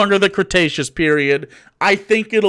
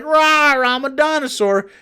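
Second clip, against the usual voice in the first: mock teen dinosaur; 8 semitones higher, slower than usual. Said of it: "Maybe when they're fifteen, like".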